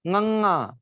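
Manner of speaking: गंगा as ŋəŋa, starting nasal; nasal pronunciation. Shown as a demonstration. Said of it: "ग का near word है". (गंगा नाक से, ङंगा)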